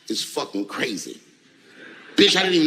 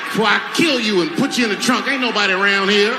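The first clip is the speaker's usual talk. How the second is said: yelling threats at a heckler, hammed-up; mock rage shout; 4 semitones higher, 3 dB louder than usual. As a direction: shout it like you've snapped, louder and higher. Mock outburst at heckler, then denied.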